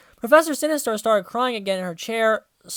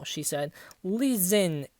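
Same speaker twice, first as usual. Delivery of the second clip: ordinary delivery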